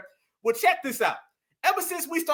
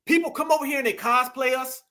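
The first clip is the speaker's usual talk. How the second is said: ordinary talk, nothing marked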